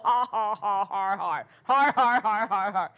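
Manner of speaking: deliberate har-har laugh, swelling in the second burst; put-on belly laugh, dropping at the end; imitated defiant laugh. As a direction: mimic laughing in the devil's face. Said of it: "I'm laughing at you".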